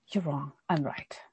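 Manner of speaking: hushed retort. Acted. Saul defensive, quietly voiced.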